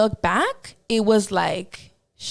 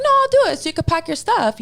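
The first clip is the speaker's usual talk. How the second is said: insisting; 7 semitones higher, 4 dB louder, faster than usual. Retold or imitated. Insisting back, quick.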